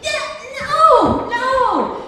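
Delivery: loud, very high-pitched no, no; panicked shout. Retold or imitated. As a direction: yell it as the boat heads for the piling. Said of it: "and I'm going".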